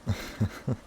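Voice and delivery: breathy high embarrassed giggle; a shy little laugh caught off guard; flustered laugh. Acted. Embarrassed at being teased.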